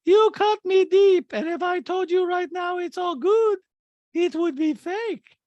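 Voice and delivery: mocking Diana at lunch; high squeaky mock drama. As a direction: whine it like a wounded diva. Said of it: "Diana goes".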